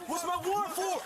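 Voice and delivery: high-pitched, insistent demand; agitated demand. Real, not acted. Suspect demands reason for arrest.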